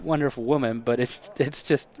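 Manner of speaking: ordinary talk, nothing marked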